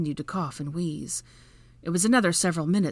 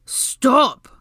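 loud, forceful command; holler; 9 semitones higher, 11 dB louder than usual. A narrator's reading. Draco drowning out Hermione's calls, loud holler. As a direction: holler over someone else's shouting. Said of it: "Draco hollered over her".

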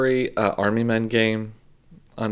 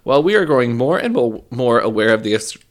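ad read; 4 dB louder than usual. Acted. Reads a sponsor ad.

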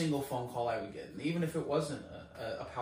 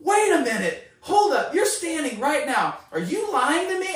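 loud, high, shouted disbelief; freaking out; 12 semitones higher, 11 dB louder than usual. Retold or imitated. Prayer caller learns she was in a wheelchair, freaking out. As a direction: re-enact losing it on the phone. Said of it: "freaking out on the phone".

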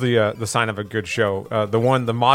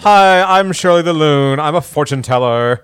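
lightly voiced Valley Girl fortune teller; breezy Valley Girl, louder and higher; Shirley the Loon voice; 3 semitones higher, 6 dB louder than usual. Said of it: "very Valley Girl kinda voice".

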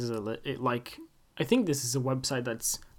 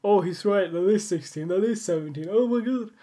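higher, louder mock astonishment; amazed listener; 6 semitones higher, 5 dB louder than usual. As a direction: play a listener blown away. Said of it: "you probably went like".

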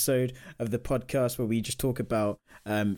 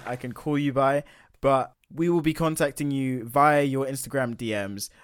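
ordinary talk, nothing marked

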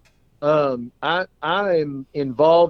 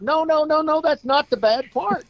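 much higher, hammed-up protest; insistent clown; 14 semitones higher than usual. Clown correcting his friend, hammed-up insistent. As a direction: wave off his pity, insisting.

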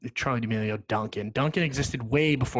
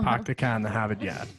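Boston accent. Shown as a demonstration. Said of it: "It's very Boston".

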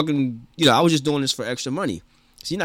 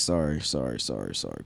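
fading apology; 11 semitones lower, 10 dB quieter than usual. Real, not acted. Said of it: "apologizing on air, fading".

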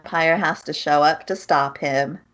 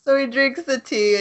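usual speaking voice

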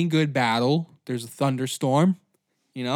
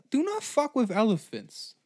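ordinary delivery

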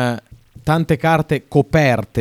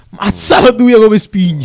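loud, slow, high Roman catchphrase; Roman accent; 7 semitones higher, 6 dB louder, slower than usual. Retold or imitated. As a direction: belt it out like a Roman foodie. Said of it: "commenta un cibo con l'anduia dentro e dice".

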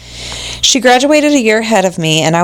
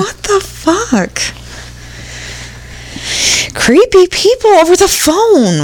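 freaked out; 12 semitones higher, slower than usual. Real, not acted. Reacting to creepy story.

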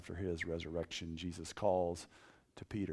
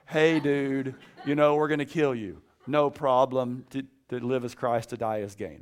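mock threat then breezy reply; 5 semitones higher, 10 dB louder than usual. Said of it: "like, Paul".

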